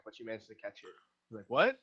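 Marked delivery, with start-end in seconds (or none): 1.5-1.8 startled bartender, pitched up and louder; sudden high "what". Acted. GM voices the distracted bartender, startled.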